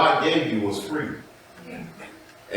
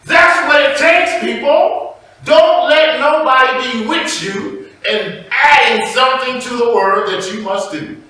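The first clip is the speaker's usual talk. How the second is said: loud, raised-pitch sermon exhortation; preaching at full force; 11 semitones higher, 8 dB louder than usual. Real, not acted. Preacher warning his people against added rules, forceful preaching. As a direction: drive it home to the congregation.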